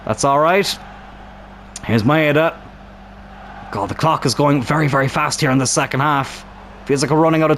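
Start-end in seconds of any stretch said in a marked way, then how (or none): none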